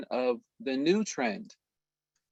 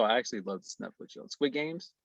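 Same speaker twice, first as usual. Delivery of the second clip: ordinary delivery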